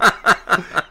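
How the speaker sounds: loud burst of real laughter. Real, not acted. Laughing at his own childhood fight story, loud laugh. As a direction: let a real laugh break out, high and loud.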